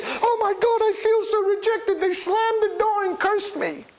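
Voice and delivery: high whiny mock wail; mock self-pity. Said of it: "you don't take this stuff personally".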